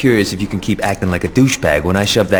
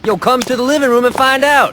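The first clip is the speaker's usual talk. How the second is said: much higher, carrying call to another room; Johnny calls out to Aisha from the living room. Acted. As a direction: call out to her teasingly.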